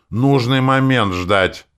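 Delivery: loud, high, slow exasperated burst; flaring up. A narrator's reading. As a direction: lose patience on every word. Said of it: "вспылил я". (вспылив, громко и раздражённо)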